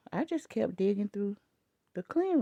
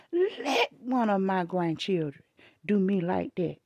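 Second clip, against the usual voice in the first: ordinary delivery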